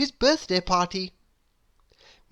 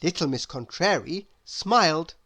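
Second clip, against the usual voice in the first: usual speaking voice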